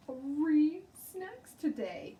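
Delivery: high, loud and slow boast; excited brag. A narrator's reading. Junie B showing off her tickets to the teacher, bragging. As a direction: draw it out proudly. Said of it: "bragging about snack tickets".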